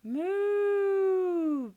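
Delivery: very high, loud, long cow bellow; bellowing moo. A narrator's reading. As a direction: let the cow bellow as she snatches the carrot. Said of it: "bellowed Belinda".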